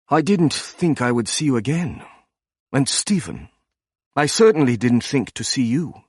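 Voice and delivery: hint of a softened German accent; lightly voiced German academic, his German accent rounded and soft. A narrator's reading. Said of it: "The German accent was soft".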